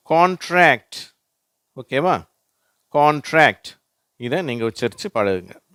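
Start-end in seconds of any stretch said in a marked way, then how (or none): 0.1-1.1 கான்ட்ராக்ட் said high, loud and slow with ntr blended; teacher modelling கான்ட்ராக்ட் for the class; model word contract. Shown as a demonstration. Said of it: "அதுக்கு உதாரணம்". 2.9-3.8 கான்ட்ராக்ட் said high, loud and slow with ntr blended; teacher modelling கான்ட்ராக்ட் for the class; model word contract. Shown as a demonstration. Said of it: "அதுக்கு உதாரணம்".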